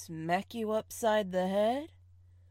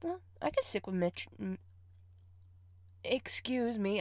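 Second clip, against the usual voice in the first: ordinary talk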